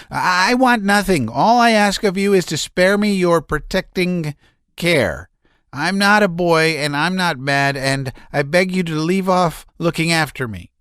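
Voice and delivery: high, strained, nervous voice; a man near hysterics pouring it out; agitated Laevsky. A narrator's reading. Laevsky's outburst continues.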